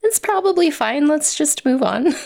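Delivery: high, airy brush-off; mock breezy dismissal. Author imitating herself skipping corrections, breezy and dismissive. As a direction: shrug it off with a lightly mocking lilt. Imagining an author waving changes off.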